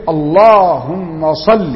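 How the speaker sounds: "اللهم صل" stretched out slowly as a teaching example; مثال ممدود ببطء. Shown as a demonstration. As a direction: draw out "صل" for the class. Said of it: "أو لما أقول لك إيه". (نطق "اللهم صل" ببطء شديد للتوضيح)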